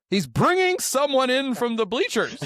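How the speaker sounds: high-pitched, playful outrage; hammed-up disbelief, pitched high; mock incredulous protest. Acting out manager's outraged protest.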